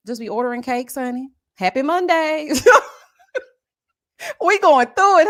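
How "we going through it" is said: excited. Real, not acted. Loud exclaim.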